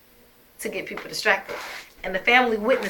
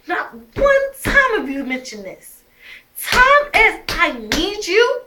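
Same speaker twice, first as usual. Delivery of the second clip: higher and louder, a retold blow-up at him; retold angry outburst; 7 semitones higher, 4 dB louder than usual. Retelling to the camera how she yelled at Darren, mock furious. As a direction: imitate your furious self, pitch climbing, slowing on the last line. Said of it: "I was so upset 'cause I'm like".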